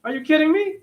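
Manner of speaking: high, disbelieving squeal; incredulous. Real, not acted. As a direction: throw it out in mock disbelief. Comic disbelief after the story.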